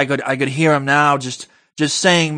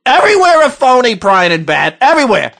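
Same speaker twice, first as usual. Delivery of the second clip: ranting shout; 6 semitones higher, 3 dB louder, faster than usual. Acted. Ranting outburst.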